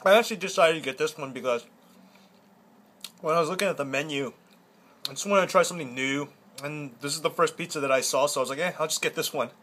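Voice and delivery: speaking between bites; chewing while talking. Real, not acted. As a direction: mouth partly full. Seen 4: Earlier pizza bite; lips work and cheeks puff between words.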